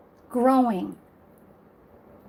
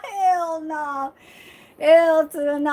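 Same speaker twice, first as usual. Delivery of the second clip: higher, louder dismissal; emphatic refusal; 5 semitones higher, 5 dB louder than usual. Real, not acted. Rejecting the childish gesture after laughing, emphatic higher refusal. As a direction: throw it out high and emphatic, fresh from laughing.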